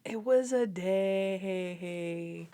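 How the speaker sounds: very slow, stretched-out playful objection; mock-pleading, elongated delivery; drawn-out protest. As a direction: stretch DAY into a long, wobbling tail. Playfully protesting the pie's age.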